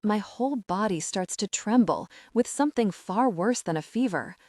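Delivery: usual speaking voice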